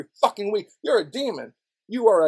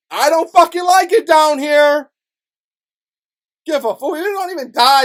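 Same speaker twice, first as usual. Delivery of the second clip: loud, higher bitter shout; 7 semitones higher, 8 dB louder than usual. Real, not acted. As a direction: yell out the frustration. Angry rant.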